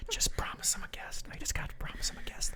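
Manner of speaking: unvoiced whisper aside. Real, not acted. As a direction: whisper it so they can't hear. Whispering to co-host off mic.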